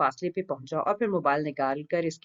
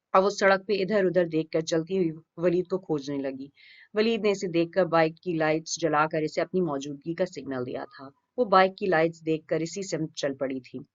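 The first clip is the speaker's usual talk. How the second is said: usual speaking voice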